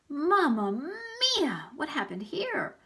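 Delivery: high, slow, loud Italian-flavored exclamation; Aldo's exclamation. Acted. Custodian Aldo entering the messy room, loud stretched mamma mia. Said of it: "Aldo said".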